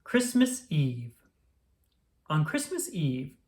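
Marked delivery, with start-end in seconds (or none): none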